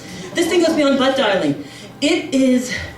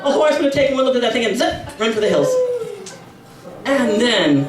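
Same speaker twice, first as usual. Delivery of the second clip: ordinary delivery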